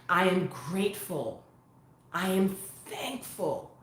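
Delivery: slow, soft affirmations with long pauses; calm yourself, one unhurried phrase at a time; mantra recitation. Shown as a demonstration.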